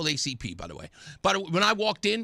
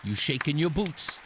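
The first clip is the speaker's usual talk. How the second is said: ordinary talk, nothing marked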